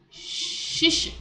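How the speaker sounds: "shishi" with a long hushing sh; SH sound demonstration. Shown as a demonstration. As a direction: draw out the sh in "shishi". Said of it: "The S with the H will be".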